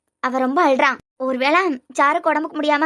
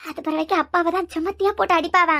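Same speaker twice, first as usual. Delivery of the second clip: ordinary talk, nothing marked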